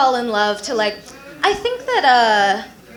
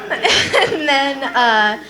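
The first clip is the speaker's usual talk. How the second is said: usual speaking voice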